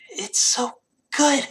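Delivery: put-on high, breathless drunken whine; slow, squeaky drunkard's protest; whining drunk. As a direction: drag it out in a whispery, pleading slur. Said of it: "And Lucius goes".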